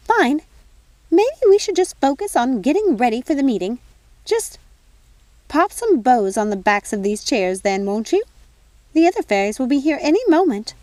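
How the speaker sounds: a busy fairy godmother fussing over tea; fussy fairy godmother. Acted.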